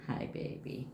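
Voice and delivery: low, soft hush to the dog. Real, not acted. To her dog Chewy, soft low greeting. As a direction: murmur a greeting to a pet.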